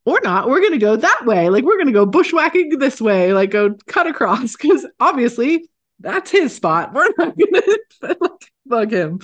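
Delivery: high, loud, laughing backing-off voice; nervous retreat. Retold or imitated. Telling riders to detour around the bear, high and loud. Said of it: "And I was like".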